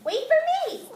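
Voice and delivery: a small fish calling high after the big one; little fish calling. Acted. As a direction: call out high and drawn-out, like a tiny fish chasing. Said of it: "he called".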